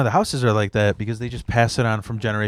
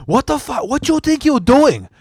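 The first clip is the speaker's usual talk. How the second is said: put-on angry driver ranting in a much higher Japanese accent; 13 semitones higher, 3 dB louder than usual. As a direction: yell at the cutting-off driver in a high Japanese accent. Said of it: "but in a Japanese accent".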